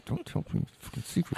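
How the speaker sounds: hushed urgent whisper. Real, not acted. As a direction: whisper it so no one hears. Hushing a friend's joke.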